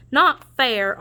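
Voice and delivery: plain reading voice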